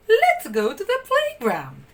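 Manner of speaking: high, bright nanny voice; nanny leading the puppies; cheerful Nanny. A narrator's reading. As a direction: invite the pups out. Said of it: "Nanny said".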